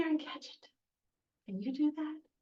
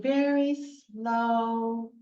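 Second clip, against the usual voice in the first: "very slow" stretched right out; much slower than usual. Shown as a demonstration. Teacher showing children opposites with a scarf, very slow. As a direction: draw out "slow" long and steady. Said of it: "I can do opposites".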